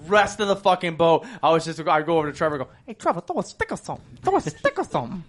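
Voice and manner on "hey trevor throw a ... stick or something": higher, quick lisped "stick or something"; imitating a lisp. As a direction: mimic the guide's lisp on "throw a stick". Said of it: "he had, like, a list".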